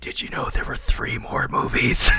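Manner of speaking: put-on ominous whisper to the kid; whispered teasing to a scared kid. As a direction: whisper it like a spooky secret. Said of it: "he should have whispered in the kid's ear".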